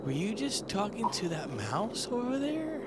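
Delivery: a princess asking in disbelief. Retold or imitated.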